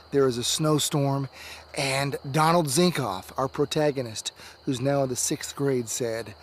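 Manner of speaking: ordinary delivery, nothing marked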